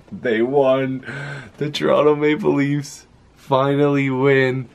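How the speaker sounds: real joy, voice lifting; overjoyed. Real, not acted. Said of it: "I could cry, I'm so happy right now".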